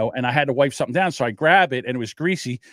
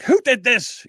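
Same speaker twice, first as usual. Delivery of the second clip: high, loud boss's bark; imitated yelling; 11 semitones higher, 5 dB louder, slower than usual. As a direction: mimic a screaming chef in the kitchen. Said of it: "he's screaming and yelling at people in the kitchen".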